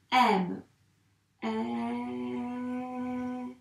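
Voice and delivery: "M" drawn out as the letters are traced; writing together on the slate; dictated while writing. Shown as a demonstration. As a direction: say the word slowly along with the pen. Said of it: "on le fait directement sur l'ardoise".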